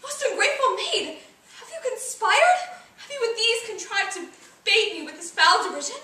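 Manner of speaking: theatrical, wounded outrage; indignant accusation. Acted. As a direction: accuse a friend who has turned on you. Acted quarrel, accusing Hermia.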